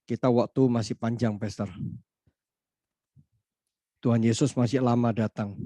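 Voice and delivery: ordinary delivery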